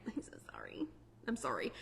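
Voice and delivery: hushed, whispered apology; whispering. Real, not acted. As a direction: whisper the sorry under your breath. Sympathy to Californians, joking.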